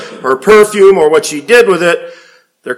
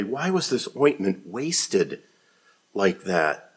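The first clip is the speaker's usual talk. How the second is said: soft, muttered under the breath; quiet indignant muttering; 8 semitones lower, 13 dB quieter, slower than usual. A narrator's reading. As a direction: grumble it softly to yourself. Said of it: "said to themselves indignantly".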